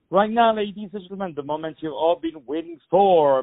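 high, fast radio announcer build-up; announcer hype. Acted. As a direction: sell it like a big reveal. Radio host hypes the song.

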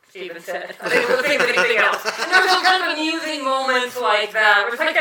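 ordinary talk, nothing marked

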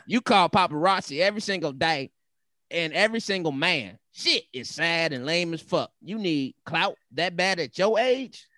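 sass it up, dragging out the digs; Montana, sassy angry woman. Acted. Reading the wife's post, sassy and angry. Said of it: "she all sassy and shit".

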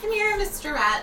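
high sing-song call to a pet; coaxing pet voice. Acted. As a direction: coo at the little rat. Calling the rat sweetly.

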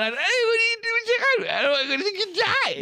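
very high, squealing hysterics; mock panic. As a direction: squeal like a terrified soldier, hammed-up. Mimicking everyone panicking in every trench.

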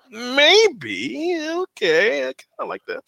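high, unsure falsetto maybe; hesitant approval. Retold or imitated. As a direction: lift way up, tentatively warming to it. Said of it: "you're like".

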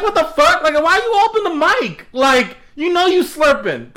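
high, incredulous retold rant; exasperated complaint. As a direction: call him out in a high, outraged voice. Retold complaint at noisy gamer.

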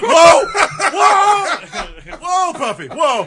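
high, loud alarmed shouts of whoa; loud mock yelling. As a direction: yell whoa like a panicked onlooker. Acts out the yelling at practice.

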